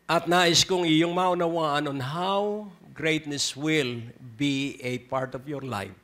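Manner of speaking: usual speaking voice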